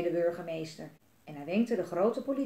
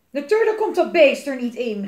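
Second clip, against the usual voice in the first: loud, raised-pitch angry shout; angry yell; 4 semitones higher, 11 dB louder than usual. A narrator's reading. Papa snaps back at mama, yelling angrily. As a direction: yell back crossly as the father. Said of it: "gilde papa kwaad".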